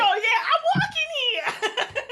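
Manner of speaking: put-on loud, slow, laughing New York accent. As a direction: play a tough New York pedestrian, half laughing. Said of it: "my New York accent is terrible".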